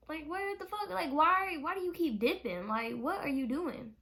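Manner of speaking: exasperated. Real, not acted. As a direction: vent disbelief that he ran off again. Exasperated at Lance leaving again.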